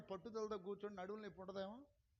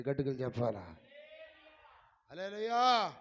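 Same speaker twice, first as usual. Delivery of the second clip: full-throated shout of praise; loud hallelujah; 5 semitones lower, 13 dB louder than usual. Real, not acted. Preacher rousing the congregation with a loud shout. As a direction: shout it to rouse the hall.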